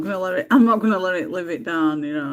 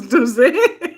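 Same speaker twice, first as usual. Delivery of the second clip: laughing; 6 semitones higher, 8 dB louder than usual. Retold or imitated. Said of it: "He goes".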